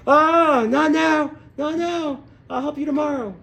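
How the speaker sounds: very high, strained cry; acted panting. Exhausted father brushing off his son mid-run, panting and strained. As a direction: wail it out loud, then ease off. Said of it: "He's panting".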